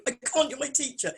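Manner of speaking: high, mock protesting voice; to her old teacher, high and mock protesting; imitating younger self. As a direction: squeak it like an embarrassed pupil. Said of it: "I went".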